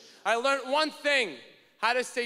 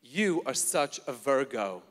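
very low, seductive, laughing croon; smooth pickup line; 12 semitones lower than usual. Retold or imitated. Comedian crooning a pickup line to Stephanie. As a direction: purr it to charm her. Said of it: "I said to her".

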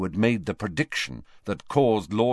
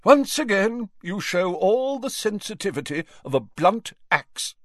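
affronted; 12 semitones higher than usual. A narrator's reading. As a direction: take lofty offence as a pompous ghost. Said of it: "in affronted tones".

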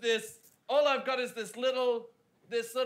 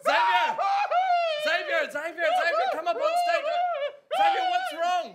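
higher, louder calls with a laugh; calling out; 6 dB louder than usual. Acted. Ben calling his scared partner onto the stage, loud and high. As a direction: coax him out from offstage.

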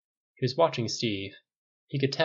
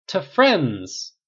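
louder, higher echoing toast; bigger toast; 7 semitones higher, 9 dB louder than usual. A narrator's reading. The group echoing Steve's toast, louder and higher. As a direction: everyone shouts it back, fuller.

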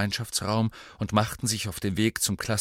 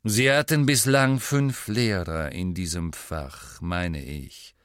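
slow, deliberate schoolmaster, starting firm and dropping; stern lecturing; slower than usual. Acted. Snape reviews the class's former teachers, stern. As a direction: measure out each word with authority.

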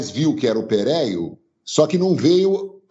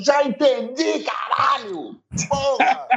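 angry outburst; 13 semitones higher, slower than usual. Retold or imitated. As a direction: snap at the pestering fans. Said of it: "o Pereira falou assim".

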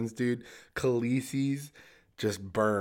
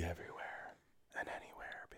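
soft breathy whisper; 4 semitones lower, 17 dB quieter than usual. Real, not acted. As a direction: whisper it mysteriously. Joke about God.

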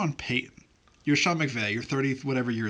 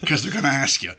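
louder, urgent PR handler coaching his boss; mock PR aide hissing advice at the coach; 7 dB louder than usual. PR person pulling Sean aside after the game, louder and urgent. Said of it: "came out of the game and was like".

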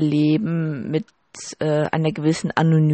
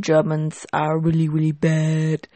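Donald Trump impression. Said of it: "von wegen".

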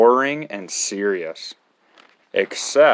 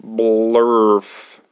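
silly nonsense word; 4 dB louder than usual. Acted.

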